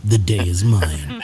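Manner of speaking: villain voice. Retold or imitated. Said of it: "Turtle's like".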